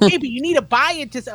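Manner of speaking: high-pitched imitation of an insistent mother; mom yelling. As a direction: keep pushing like a noisy mom. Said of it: "You're yelling".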